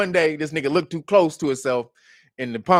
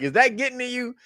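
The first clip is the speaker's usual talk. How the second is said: higher teasing repeat through a laugh; mocking taunt; 8 semitones higher than usual. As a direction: needle him, high and taunting. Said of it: "It was like".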